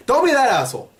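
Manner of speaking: ordinary talk, nothing marked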